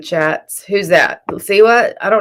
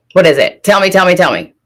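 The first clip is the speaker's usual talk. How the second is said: mock demand; 7 dB louder than usual.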